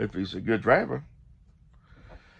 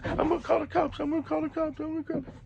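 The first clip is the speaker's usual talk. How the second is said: mock panicky squeal; faster than usual. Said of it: "He gives".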